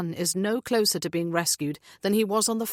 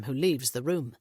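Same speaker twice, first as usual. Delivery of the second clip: ordinary delivery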